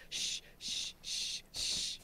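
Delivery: soft unvoiced shushing; whispering over a crib. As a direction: shush like soothing a baby. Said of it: "I love doing that when doing silent takedowns".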